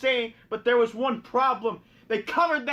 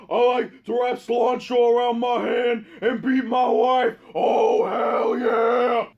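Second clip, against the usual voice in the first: louder, rough put-on tough-guy voice; Stone Cold impression; 4 dB louder, slower than usual. Stone Cold bragging to the crowd, loud tough-guy voice. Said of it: "Stone Cold's like".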